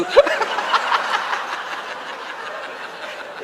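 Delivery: real airy laugh, high and soft; high breathy laugh. Real, not acted. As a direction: laugh silently at your own joke. Preacher laughs at own joke. (tawa tertahan tanpa suara)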